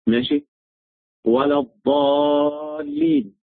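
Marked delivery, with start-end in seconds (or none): 1.3-3.4 "الضالين" slowly, with heavy dad and a long high held vowel; recited dad. Shown as a demonstration. Teacher quotes scripture as an example of the letter, recited dad. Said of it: "دي كلمة فيها الضاد".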